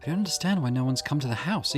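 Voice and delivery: ordinary delivery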